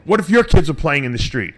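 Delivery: imitated angry challenge, high-pitched; screaming neighbor. Neighbor berating him over kids in the street, mock screaming. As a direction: scold like an outraged neighbor. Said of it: "starts screaming".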